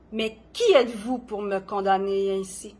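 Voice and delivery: dramatic poetry reading with long pauses; poem recitation. Acted. Poet performing own poem on gentle madness, dramatic recitation. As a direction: recite each line deliberately, swelling and falling in intensity.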